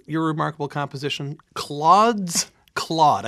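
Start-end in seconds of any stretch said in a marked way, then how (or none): 1.6-3.3 Claude's Claude said slowly and distinctly; "Claude's Claude" slow and louder, like a tongue twister; slow, careful title. Real, not acted. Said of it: "I gotta say that slow".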